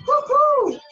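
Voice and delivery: high loud cheer; excited whoop. Real, not acted. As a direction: whoop to pump the class up. Instructor cheering class on.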